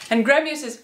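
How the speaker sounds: ordinary delivery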